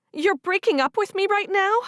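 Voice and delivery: high, loud, indignant protest; rising outrage at being dumped; upset disbelief. Acted. Sigrid protests to Matthew in high loud disbelief.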